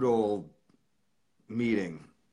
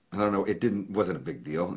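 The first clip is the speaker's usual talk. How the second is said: normal talking voice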